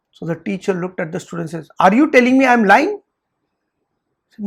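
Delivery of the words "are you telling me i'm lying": fast, louder, rising challenge; sharp accusing question; indignant accusation. Retold or imitated. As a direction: demand it like an offended teacher. Teacher accusing student in story.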